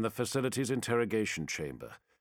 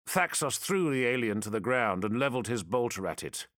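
ordinary delivery, nothing marked

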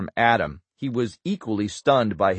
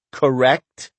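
put-on Boston accent; 3 semitones higher, 4 dB louder than usual. A narrator's reading. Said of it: "his heavy Boston accent".

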